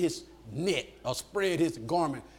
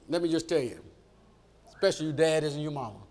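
ordinary talk

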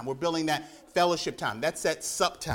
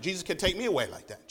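normal talking voice

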